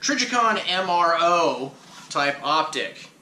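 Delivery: "Trijicon M R O" said slowly, each letter spelled out; careful slow correction. Real, not acted. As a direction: spell out "Trijicon M R O" deliberately after the slip. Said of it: "I can't talk, sorry".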